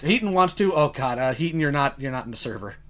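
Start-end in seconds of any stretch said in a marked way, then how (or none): none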